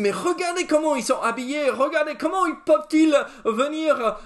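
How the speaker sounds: high-pitched imitation of a scandalised critic; mock disapproval. Preacher imitating churchgoers who criticise others' clothes, mock disapproval.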